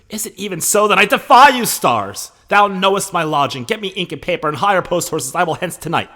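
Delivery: high, loud defiant outburst; Romeo freaking out. Acted. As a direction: cry out against the stars in shock. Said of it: "that's Romeo freaking out".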